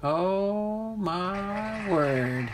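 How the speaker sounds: long, slow, high exclamation; drawn-out gasp of amazement; amazed. Real, not acted. Winner revealed on wheel.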